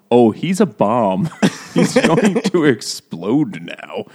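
slow, deadpan mock calm. Crew notes the bomb, slow mock calm. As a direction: announce the bomb slowly, unbothered. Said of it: "and they say very calmly".

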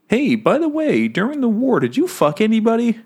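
a prying husband, voiced high and sly; mock husband. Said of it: "who had asked her".

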